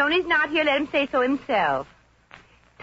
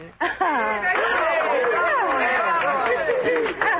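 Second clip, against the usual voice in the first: crowd laughter; 5 semitones higher than usual. Acted. Class laughs and cheers.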